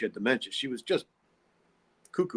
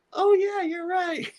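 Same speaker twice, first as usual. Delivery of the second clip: much higher, imitating a storyteller's squeaky quote voice; Lydia's high-pitched voice; 4 dB louder, slower than usual. Lydia quoting her own words in a story, squeaky high voice. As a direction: pitch way up like a sweet spacey lady quoting herself. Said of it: "she'd start talking in this real high-pitched voice".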